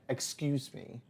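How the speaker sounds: soft, hushed polite interruption; gentle excuse me. Retold or imitated. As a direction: say it very softly, a gentle tap on the shoulder. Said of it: "put her hand ever so gently".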